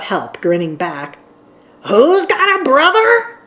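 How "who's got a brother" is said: yelled. A narrator's reading. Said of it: "yelled Huck".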